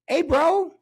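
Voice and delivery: put-on a touch of an excited recruiter pitching the kid; hyped coach. Coach spotting a tall kid in the gym, lightly hyped. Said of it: "and I'm like".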